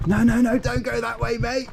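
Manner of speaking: high, quick-fire protest; urgent pleading. Real, not acted. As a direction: plead with the animal to stop. Pleading with piglet going wrong way.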